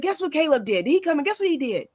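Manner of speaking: high-pitched retold excitement; so excited. Wife telling her husband what their son did, excited. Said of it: "I told him I was so excited".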